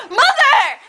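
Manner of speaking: high, loud exasperated cry; mock mad. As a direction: yell it at mom in mock outrage. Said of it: "And so then I got, like, really mad".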